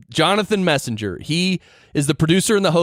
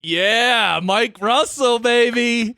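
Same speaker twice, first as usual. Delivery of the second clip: high, whooping shout-out; excited cheer; 8 semitones higher, much slower than usual. Real, not acted. Host hailing a guest after his congratulations clip, excited cheer. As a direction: cheer him on, pitch soaring.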